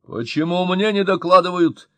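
high, loud indignant outburst; really angry. A narrator's reading. Official angered at not being told, loud indignant demand. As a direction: demand it like an offended boss. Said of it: "совсем уж рассердился Мощин".

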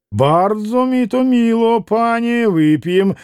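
self-important Polish gentleman; pompous, gracious. A narrator's reading. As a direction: accept grandly, with condescending favour. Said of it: "важно и благосклонно проговорил пан на диване".

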